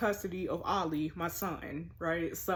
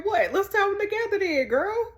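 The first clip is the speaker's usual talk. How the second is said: hammed-up mocking impression of the ex-husband; mocking falsetto ex; 12 semitones higher, 6 dB louder than usual. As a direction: voice his message much higher and louder, teasing. Mocking ex's text in high voice.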